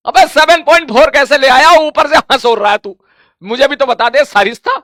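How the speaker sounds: loud teasing rebuke; mock the student who got it wrong; exasperated scolding. Real, not acted. Teacher scolding wrong answer. (ज़ोर से, चिढ़ाती हुई डाँट)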